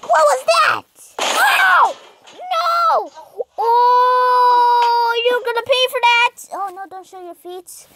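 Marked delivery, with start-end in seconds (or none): 0.0-3.1 high, loud cries of protest; alarmed shouting. Real, not acted. Player reacting to what hit baby, alarmed shout. Upset at event in game. 3.6-6.4 loud, high vow of revenge; indignant shout. Real, not acted. Player threatening whoever hurt baby, indignant shout.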